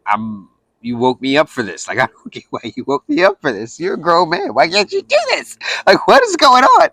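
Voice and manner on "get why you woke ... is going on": much higher, incredulous complaint; exasperated disbelief. Retold or imitated. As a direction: throw up your hands at a grown man needing help. Exasperated at being woken up.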